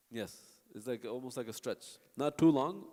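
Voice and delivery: usual speaking voice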